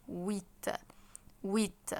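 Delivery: "ويت" starting on the W glide, like "weet"; silent H. Shown as a demonstration. Teacher models number eight for students, "ويت" with silent H. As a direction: say "ويت" with no H, clear final T. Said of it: "الأش برضو مش منطوقة".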